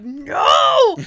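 loud, high imitation of a devastated kid; mock horrified shout. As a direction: yell it like your console is drowning. Xbox thrown into the pool.

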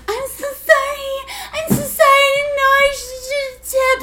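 high-pitched mock wailing; squealed fake sobbing; screaming and crying. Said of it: "Screaming and crying at my manager".

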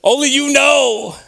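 high, loud testimony to God; impassioned, high-pitched outburst; fervent preaching. Real, not acted. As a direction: raise it to a preacher's cry. Preaches at full force.

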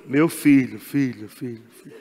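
low, fading, mock-divine calling of filho; God's voice. God mockingly answering a grumbling believer, deep and fading.

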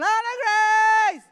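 a long, high, loud call of a name; desperate shout. Retold or imitated. Father running round his road calling for his daughter. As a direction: yell it out as you run, drawn out. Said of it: "I'm running around our road going".

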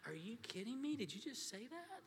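astonished father; amazed disbelief. Retold or imitated. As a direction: react like you can't believe it. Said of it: "I was like".

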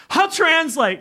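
high, eager announcement; amazed volunteer speaking up; excited offer. Retold or imitated. Stunned Amir offers to translate.

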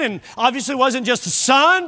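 normal talking voice

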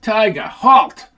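loud, high, slow yell to the dog; yelled command. Acted. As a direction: stop the dog dead with a shout. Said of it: "yelled Sid".

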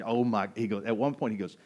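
usual speaking voice

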